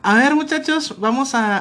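lightly voiced teacher calling pupils to attention; imitated teacher. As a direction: round up the class like a teacher. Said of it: "llega la maestra".

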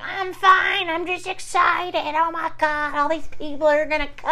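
very high, put-on overwrought voice; mock frantic reassurance. Crying host insisting she's okay before party, mock frantic. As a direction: imitate someone insisting through a meltdown. Acts out tearful fake composure.

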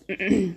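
quick throat clearing. Real, not acted. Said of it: "My body's making all kinds of noises".

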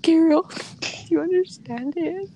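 begging for help through a laugh; desperate ask. Retold or imitated. Asking Gabriel for help, a laughing desperate plea. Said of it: "I was like".

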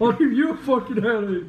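loud, high hammed-up threat with a laugh in it; mock macho threat. Imagined son threatening his dad, laughing mock macho yell. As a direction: play the tough guy, half laughing. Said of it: "They just flip out on him".

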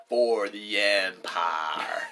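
slow drawn-out Southern drawl; Southern accent, said slowly. Retold or imitated. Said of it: "He was like".

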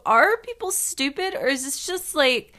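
high-pitched, animated retold question; retold excited wondering aloud; eager questioning. Retells own eager questioning.

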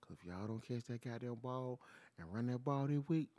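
normal talking voice

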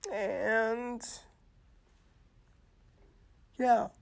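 high, strained near-tearful voice; barely holding it together; choked up. Real, not acted. Host reassuring viewers while fighting back tears. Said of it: "Sorry, I'm getting a little emotional".

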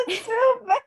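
high-pitched words breaking into laughter; helpless laughter. Real, not acted. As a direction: laugh so hard the words squeak out.

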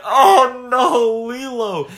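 high, loud mock wail of grief; retold anguish. Retelling her Stitch movie reaction, high mock wail. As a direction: cry out as if the heart breaks. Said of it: "And I'm like".

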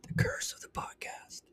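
spooky hushed whisper. Pretend. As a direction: whisper it like a ghost story. Spooky aside after dead air.

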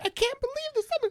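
mock the dressed-up guy wailing over the result; upset fashionable guy. Impersonating the TikTok guy's dismay, high put-on voice. Retold metrosexual guy's outraged reaction.

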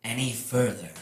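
usual speaking voice

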